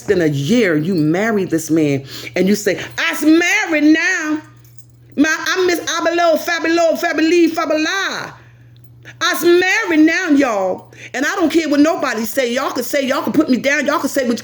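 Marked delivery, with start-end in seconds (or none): 3.0-10.9 mock-proud newlywed announcement, pitched way up; mocking bragging quote. Mocking impression of the listener bragging. 11.1-14.4 mock defiance. Continues the mocking quote of her.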